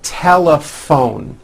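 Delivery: TELEPHONE slowly, PH as a clear airy F. Shown as a demonstration. Teacher modelling PH as F, slow TELEPHONE. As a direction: spell out TELEPHONE by ear, lingering on the F. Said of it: "is also pronounced as an F".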